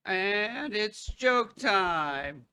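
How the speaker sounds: slow, cracking announcer voice. Real, not acted. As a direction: voice cracks mid-line. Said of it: "My voice cracked".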